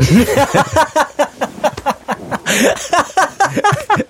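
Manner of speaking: long high laugh breaking up; big laugh. Real, not acted. Telling the Mister Loaf story, bursting into high laughter. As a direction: crack up helplessly at the joke.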